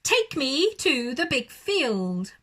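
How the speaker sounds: loud, slow, drawn-out order; loud demand. Acted. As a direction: stretch the command out grandly. Owl ordering sheepdog.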